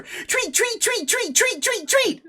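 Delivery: very high, excited chirps in quick succession; rapid bird chirping. Acted. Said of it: "starts chirping faster".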